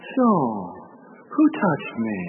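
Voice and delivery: calm Jesus. Pretend. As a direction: settle in for a chat. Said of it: "Jesus is just like".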